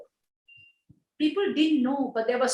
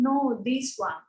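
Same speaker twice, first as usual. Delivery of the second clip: tearful whisper; slower than usual. Retold or imitated. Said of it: "she went on sobbing".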